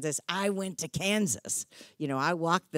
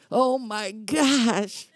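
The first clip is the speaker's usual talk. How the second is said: laughing exasperation; 6 semitones higher, 5 dB louder, much slower than usual. Real, not acted.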